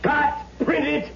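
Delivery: high, calling cut and print; excited director. Acted. Film director halting the take, high and excited. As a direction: snap out the call over the set.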